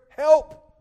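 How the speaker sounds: sudden loud, high cry of help; pleading cry. Retold or imitated. Preacher crying out to the Holy Spirit, loud plea.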